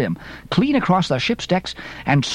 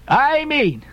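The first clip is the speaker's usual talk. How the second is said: emphatic, pitched-up captain's retort; gruff captain; 10 semitones higher than usual. A narrator's reading. Gruff Peleg answering from wigwam.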